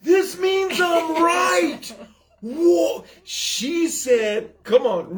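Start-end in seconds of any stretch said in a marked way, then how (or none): 0.0-1.9 high triumphant yell; excited shout. Real, not acted. Triumphant outburst. 2.4-3.0 high excited whoa; excited shout. Real, not acted.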